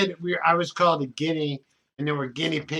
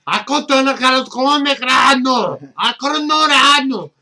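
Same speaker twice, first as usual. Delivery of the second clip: the same line as mock Middle Eastern gibberish, higher and louder; mock Middle Eastern language; 7 semitones higher, 8 dB louder than usual. A comic repeats the bit in a mock Middle Eastern language, high and loud. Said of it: "we have it in four languages".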